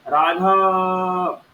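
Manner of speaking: Raghav called out, the final आ stretched very long; calling from afar. Shown as a demonstration. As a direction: hail a friend far away, drawing out Raghav. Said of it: "किसी मित्र को दूर से बुलाया". (दूर से पुकारते हुए राघव)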